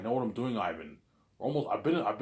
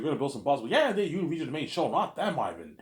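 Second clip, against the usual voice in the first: ordinary talk, nothing marked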